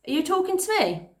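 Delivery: high and loud challenge; defiant challenge. Acted. Esther confronting the grinning boy, loud and high. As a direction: square up to him, pitch high. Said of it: "Esther asked".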